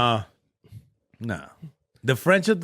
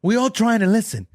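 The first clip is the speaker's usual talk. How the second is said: higher-pitched mock complaint; indignant shushing, pitched well up; 7 semitones higher, 3 dB louder than usual. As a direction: imitate a lady telling off noisy moviegoers.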